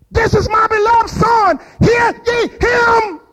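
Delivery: booming high shouted voice of God; voice from heaven. Acted. God speaking from heaven, booming high shout. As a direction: thunder it out like God from the clouds. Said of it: "they heard a voice say".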